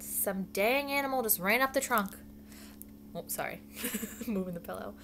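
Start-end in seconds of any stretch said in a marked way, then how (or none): 0.0-2.2 raised, higher-pitched call; yell. A narrator's reading. Dave explaining the animal on the trunk, mild yell. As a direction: call it out to the crew. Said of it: "Dave yelled".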